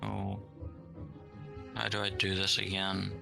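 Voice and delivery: ordinary talk, nothing marked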